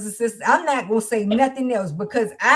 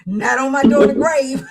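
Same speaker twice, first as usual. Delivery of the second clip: laughing; 7 dB louder than usual. Real, not acted. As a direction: vow said through a laugh. Laughs while repeating vow.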